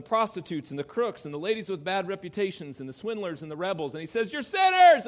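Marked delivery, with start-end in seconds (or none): 4.3-5.1 high, booming declaration; shouted accusation. Acted. Jesus to fornicators and crooks, loud shouted accusation. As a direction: holler it at the whole crowd.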